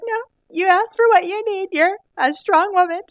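very high, emphatic cheerleading; retold empowering pep talk.

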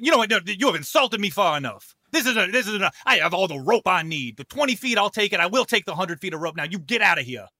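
indignant showman preacher; Miracle Michael, offended. Acted. Preacher insulted by an offer of rope, indignant refusal. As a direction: take offence and order them out. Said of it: "Michael says".

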